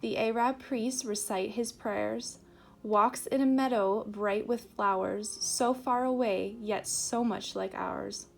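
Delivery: measured rhymed verse, firmly projected; poem reading. Acted. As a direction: recite the verse out to listeners, pausing between lines. Reads a poem aloud.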